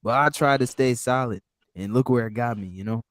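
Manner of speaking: ordinary talk, nothing marked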